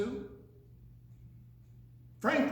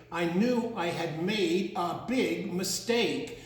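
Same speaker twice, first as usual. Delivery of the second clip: usual speaking voice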